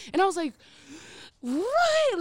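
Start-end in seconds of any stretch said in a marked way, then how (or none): 1.4-2.2 high-pitched, shocked outburst; a loud, high cry of disbelief. Retold or imitated. Acted shocked reaction to the story.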